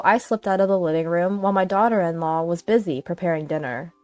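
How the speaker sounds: ordinary delivery, nothing marked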